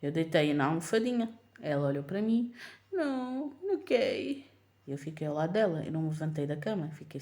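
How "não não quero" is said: put-on higher toddler protest; toddler whine. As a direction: whine it like a small child. Toddler refusing to sleep.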